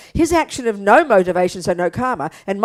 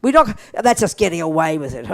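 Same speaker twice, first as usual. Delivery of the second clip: vindictive; 7 semitones lower than usual. Retold or imitated. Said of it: "But we are very vindictive".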